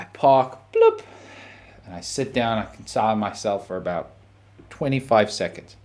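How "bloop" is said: high electronic bloop; imitated car beep. Comedian mimics the car's beep as he parks, high bloop. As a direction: chirp like a car locking.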